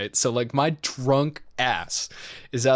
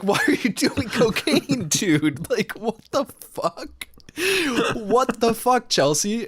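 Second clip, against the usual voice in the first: incredulous; 9 semitones higher than usual. Retold or imitated.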